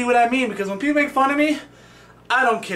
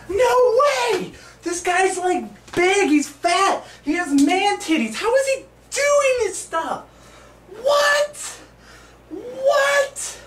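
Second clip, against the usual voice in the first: very high, stretched-out squeals of disbelief; mock stunned spectator; 11 semitones higher, much slower than usual. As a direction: ham up an amazed bystander. Plays amazed onlooker at his own trick.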